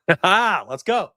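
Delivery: normal talking voice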